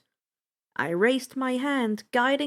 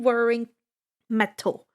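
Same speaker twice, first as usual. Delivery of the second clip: plain reading voice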